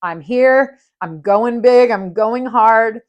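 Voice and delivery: loud, pumped-up declaration; psyched-up quote. Retold or imitated. To herself before the third ayahuasca dose, fired-up. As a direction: hype yourself up to go all in. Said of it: "but I was like".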